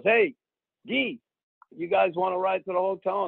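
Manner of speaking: high-pitched hail, imitating a friend; calling out. Friend offering star players a ride, calling out.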